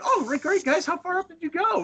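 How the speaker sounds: a hammed-up polite local humoring tourists; mock enthusiasm. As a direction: play along with exaggerated interest. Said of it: "I'm like".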